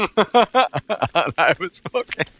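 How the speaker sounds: words squeezed out through laughter; laughing while talking. Real, not acted. Telling viewers about the joke, laughing.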